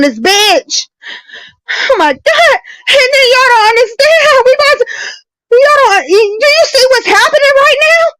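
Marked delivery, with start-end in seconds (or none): 1.7-8.0 high, crying shout, voice breaking; sobbing it out, pleading. Real, not acted. Breaks down crying mid-argument.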